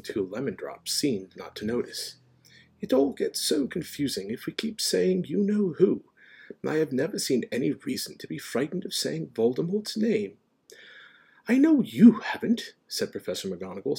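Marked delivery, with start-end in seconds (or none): 2.8-10.4 reasonable wizard, higher pitch; Dumbledore. A narrator's reading. Dumbledore unafraid of the name, mildly reasoning. 11.5-12.7 high, slow, loud concession; half exasperated, half admiring. A narrator's reading. McGonagall conceding to Dumbledore, exasperated yet admiring. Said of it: "sounding half exasperated, half admiring".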